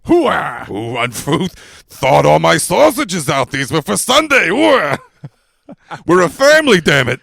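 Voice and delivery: loud gung-ho soldier bark; hammed-up hoarse Pacino yelling hooah; bad Al Pacino. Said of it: "bad Pacino".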